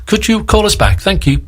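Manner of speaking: a hint of high, sing-out receptionist; casting office caller. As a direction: leave a polite voicemail in a lifted voice. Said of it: "casting department here at Brookside".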